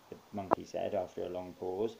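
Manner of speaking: plain reading voice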